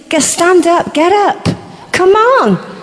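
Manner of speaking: rousing, high and loud preacher's call; fiery preaching. Acted. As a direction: stir the crowd to its feet. Preaches at full force.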